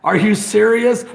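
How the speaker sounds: usual speaking voice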